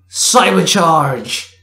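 drawn-out heroine call. A narrator's reading. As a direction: stretch out the power-up shout. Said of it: "she called out".

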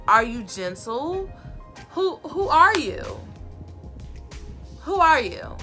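normal talking voice

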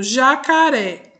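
"jacaré" drawn out slowly, stress on the final "ré"; slow teacher's demo of "jacaré", "ré" strongest; stress on final syllable. Shown as a demonstration. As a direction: sound out "jacaré" slowly, landing on its last syllable. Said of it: "O ré é a última sílaba e é a sílaba".

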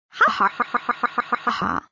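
mocking, triumphant laughter; gloating laugh. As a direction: laugh at her downfall.

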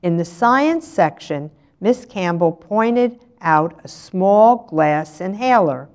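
usual speaking voice

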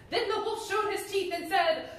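put-on lively read-aloud to children; animated storyteller voice; storybook reading. A narrator's reading. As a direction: tell it like a bedtime story to kids.

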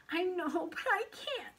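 put-on high, pleading boy; torn protest. A narrator's reading. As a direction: protest helplessly against temptation. Paul torn, resisting.

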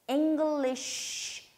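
"English" drawn out with a high first syllable; first-syllable stress. Shown as a demonstration. Teacher showing where the accent goes, "English" slowly stressed on ENG. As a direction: stretch "English", leaning on ENG, long final sh. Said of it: "첫 번째 강세 주셔야 되고".

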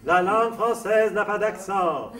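loud, high, drawn-out declaration; emphatic proclamation. Retold or imitated. Singer proclaims Romain Rolland's great phrase to the audience, emphatic. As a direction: proclaim it slowly like a famous dictum.

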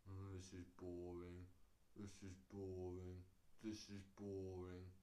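low, slow, unenthused drone; bored moan. Acted. Hyped-up people on Christmas Day, bored drone. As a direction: drone it out as if utterly fed up. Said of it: "people get this hyped up about Christmas. And then on Christmas Day, they're like this".

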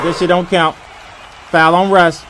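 ordinary talk

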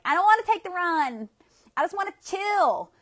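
high, loud play-whining; hammed-up whine of someone refusing exercise; mock reluctant protest. Acting out reluctance to go running.

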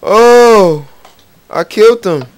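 sudden loud high "Oh!" of real shock; shocked gasp. Real, not acted. Gamer realizing he just killed a villager, shocked. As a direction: caught off guard, blurt it out. Gasps after killing a villager.

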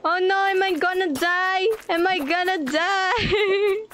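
mock panic. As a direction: fake a little terror as the monster hits you. Slime hits her, fearing death.

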